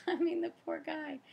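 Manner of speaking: high, laughing burst of pity for her husband. Real, not acted. Laughing at herself over husband.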